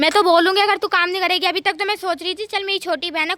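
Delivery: loud, heated comeback; angry retort. Acted. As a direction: argue back at full volume. Quarrel over housework. (ग़ुस्से में तेज़ आवाज़ में जवाब)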